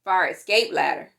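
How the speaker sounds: ordinary delivery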